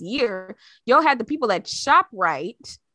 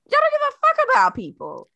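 much higher and fast; venting about gig workers, much higher; high outraged cry; 13 semitones higher, 3 dB louder, faster than usual. Real, not acted. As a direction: fling it out in disgust. Ranting at billionaires.

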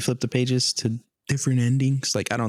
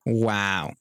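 sarcastic wow. Real, not acted. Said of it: "is that a sarcastic wow?".

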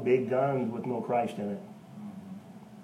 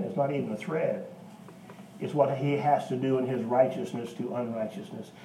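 ordinary talk

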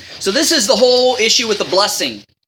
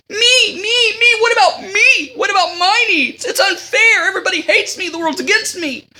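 mock the self-pitying brat, hamming it up; whiny toxic person; 11 semitones higher than usual. Mocking Esau's self-pity to the listeners, hammed-up whine. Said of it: "Sounds like a toxic person".

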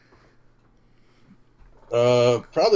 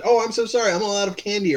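high-pitched apology in another's voice; high, polite mock apology; apologetic neighbor; 8 semitones higher than usual. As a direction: play the sorry neighbor, pitched up. Voicing what the woman should have said.

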